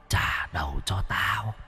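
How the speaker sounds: hushed, slow ghostly rasp demanding her head back; a dead old woman breathing a threat, drawn out and very soft; ghost's demand. A narrator's reading. Ghost old woman to Vĩnh, hushed slow ghostly demand. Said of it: "ghost old woman demanding head".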